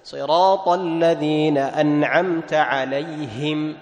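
slow chanted verse, pure "hi" in عليهم; measured Quranic model ending on a clear عليهم; clear Ha kasra. Shown as a demonstration. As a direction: recite steadily with a distinct ه in عليهم. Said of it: "بيان وتصفية كسرة الهاء".

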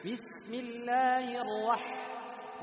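slow chant stopping on a held ح in الرح; flowing ح. Shown as a demonstration. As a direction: let the breath run through the ح of الرح. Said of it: "لاحظ الحاء". (ترتيل بطيء مع جريان الحاء في الرح)

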